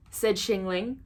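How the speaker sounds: ordinary delivery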